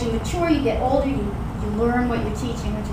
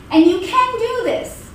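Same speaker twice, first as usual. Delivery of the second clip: ordinary talk, nothing marked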